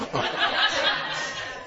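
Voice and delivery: carcajada aguda sin voz; risa aguda. Real, not acted. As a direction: se le escapa la risa. Se ríe de la toalla. (risa alta y soplada)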